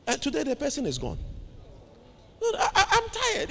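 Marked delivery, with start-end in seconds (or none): 2.4-3.5 high, soft, plaintive imitation; put-on high voice, softly protesting tiredness; tired sick man. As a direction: mimic a weary patient in a thin high voice.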